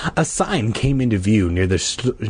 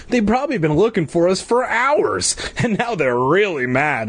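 put-on frowning boy; 8 semitones higher than usual.

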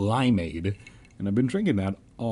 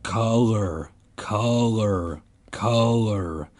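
slow, drawn-out chant; repeated by the circle; much slower than usual. A narrator's reading. Circle of gray kids echoes the word, chanted. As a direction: intone each word evenly. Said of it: "The word was repeated among the circle".

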